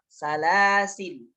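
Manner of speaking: salasil chanted slowly, ending on a still l; alif dropped. Shown as a demonstration. Teacher gives other way to stop, salasil without final a. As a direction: intone salasil, stopping on the consonant l. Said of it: "Atau tidak dibaca".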